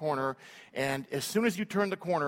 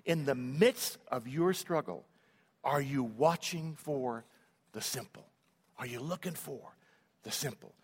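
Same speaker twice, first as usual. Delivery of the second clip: slow down and drop to a hush on the simple; slow pointed question; 3 semitones lower, 4 dB quieter than usual. Acted. Preacher asks congregation slowly, fading soft. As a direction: ask it slowly, trailing softer.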